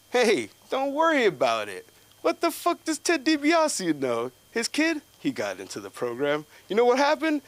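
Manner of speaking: high, loud hyped-up stranger voice. Retold or imitated. Random GameStop kid hyping him up against the coach, high and loud. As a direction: rant it like an excited fan.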